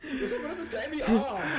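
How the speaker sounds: put-on high, laughing German accent; mock German accent. Friend teases streamer's botched accent, mock German accent. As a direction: giggle through a German impression. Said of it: "someone who's moved over from Germany".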